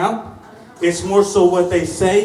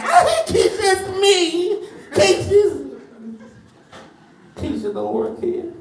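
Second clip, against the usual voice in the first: high-pitched squealed Keisha, falling off; imitating coworker; 9 semitones higher, slower than usual. Coworker outing the comic at FedEx, high mock squeal. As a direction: squeal the name then sink. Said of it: "Somebody came out the back room like".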